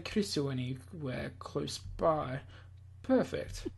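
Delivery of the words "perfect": gloating mad scientist. Acted. Villain gloating to himself.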